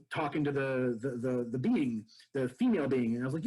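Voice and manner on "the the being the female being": usual speaking voice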